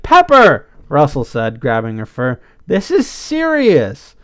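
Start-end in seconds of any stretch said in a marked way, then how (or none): 0.0-0.7 high, loud interrupting shout; exasperated call. A narrator's reading. Friend grabs the rambling skunk to stop her, exasperated call. 2.7-4.2 "serious" stretched out and pitched high; emphatic insistence. A narrator's reading. Friend insists to the joker that "serious" matters, emphatic insistence.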